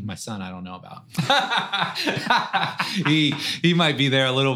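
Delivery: joking about his son staying at home, half-laughing; laughing aside. Real, not acted.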